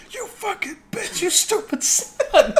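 very high, slow, laughing doll voice; Chucky. Retold or imitated. Chucky curses at the mother, very high screeching doll voice. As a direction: screech it like a possessed doll, giggling. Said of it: "And then Chucky just goes".

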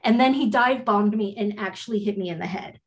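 ordinary talk, nothing marked

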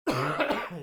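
a real chesty cough. Real, not acted. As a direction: cough once into the words. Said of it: "sorry, excuse me".

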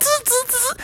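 short high bursts of buzz; bee buzzing. A narrator's reading. Bees swarming the players, buzzing. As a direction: punctuate speech with bee buzzes. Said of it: "the bees were like".

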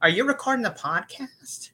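high, camped-up flamboyant question. Acted. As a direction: ask it with exaggerated flamboyance.

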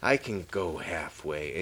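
voz muito baixa e lenta. Retold or imitated. Acting Harry's quiet resolve.

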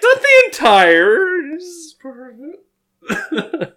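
high, drawn-out protest through laughter; laughing protest. Real, not acted. Accused co-host defends against surfing Facebook, high laughing protest. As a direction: protest slowly in a high pitch, half laughing. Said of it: "Getting pissed".

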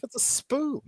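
mock Australian, pitch leaping up; Australian accent. Co-host continuing the knife bit, Australian accent. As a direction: put on Australian for a parody quote. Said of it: "taught by Paul Hogan to do that".

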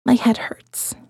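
soft unvoiced tired whisper; weary whisper. A narrator's reading. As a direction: breathe it out, worn out. Narrator overwhelmed by documents.

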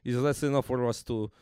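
a hint of a Russian accent, scheming; mock Russian accent. Comic voicing Russian neighbor wanting Wi-Fi, light Russian accent. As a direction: lightly voice a Russian hacker asking for Wi-Fi.

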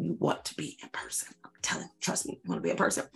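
soft unvoiced whisper, leaning in. Real, not acted. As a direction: whisper it like a secret tip to a friend. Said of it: "whispered confiding aside".

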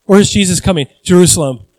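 normal talking voice

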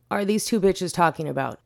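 usual speaking voice

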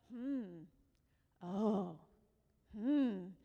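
low, concerned "hmm" and "oh" with long pauses; nurses' ominous humming. Retold or imitated. Nurses during the sonogram, murmuring ominously. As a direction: imitate a nurse's noncommittal murmurs. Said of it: "the nurses do the thing where they go".

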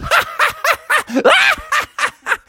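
high shrieking hyena cackle; hyena laugh. Acted. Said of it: "started laughing like a hyena".

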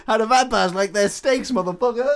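stand-up comic. Retold or imitated. Carl's joke.

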